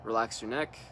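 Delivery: calm meditation leader leaving room to breathe; guided meditation. Real, not acted. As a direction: guide them into relaxing, unhurried, one short instruction at a time. Leads a guided relaxation meditation.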